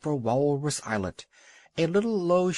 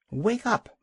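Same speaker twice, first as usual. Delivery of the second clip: sharp high bark of a wake-up call; young seal barking at the old walrus to wake up; 9 semitones higher than usual. A narrator's reading. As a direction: bark it to rouse a sleeping walrus. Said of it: "barked Kotick".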